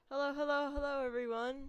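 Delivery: bright, loud, high hello; loud greeting. Real, not acted. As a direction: call out hello to the chat. Stream greeting, shouted opening.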